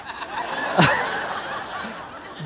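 real laugh. Real, not acted. Laughs at own lie.

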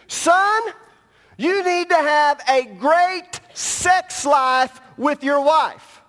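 loud and high, building to a shout; preaching shout. Acted. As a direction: hammer the point home to the crowd. Preacher voices Solomon's advice.

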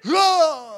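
loud, high mock cry; hammed-up startled "ah"; imitated band yell. Band seeing the singer fall off stage, loud mock yell. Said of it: "and the band's like".

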